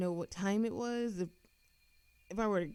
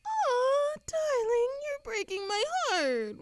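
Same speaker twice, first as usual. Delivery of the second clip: high, loud, whiny mock hurt, slowing down; hammed-up heartbreak, much higher; mock heartbroken; 7 dB louder, slower than usual. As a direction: pretend to be wounded, teasing. Teasing mock hurt at reaper.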